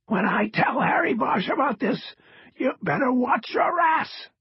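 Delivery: strained raspy threat; strong rasp. A narrator's reading. Cross threatens the agent with Bosch, strong rasp. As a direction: rasp out a warning forcefully. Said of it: "his voice the clearest and strongest".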